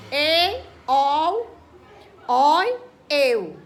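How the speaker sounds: "e, ol, oi, eu" loud, one by one; emphatic repetition. Shown as a demonstration. Teacher repeating open diphthongs for pupils, each one loud and separate.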